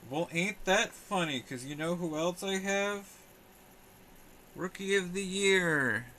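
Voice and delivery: high, loud showman build-up; slow, high game-show reveal; mock dramatic reveal. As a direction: tease it like a big announcement. Mock reveal of matching card.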